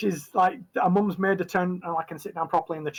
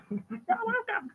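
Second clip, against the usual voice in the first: put-on little girl's reply; 4 dB quieter, slower than usual. Little girl replies shyly.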